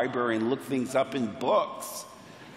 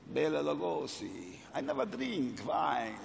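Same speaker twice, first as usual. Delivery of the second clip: put-on hushed Hungarian-accented vampire; Bela Lugosi; 8 dB quieter than usual. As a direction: purr it quietly in a thick Hungarian accent. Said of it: "I love the sound of people's accents".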